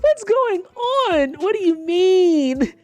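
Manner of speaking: high, slow, stretched confusion; mock bewildered asking at work; baffled disbelief. Her own reaction to the boss, high slow bafflement.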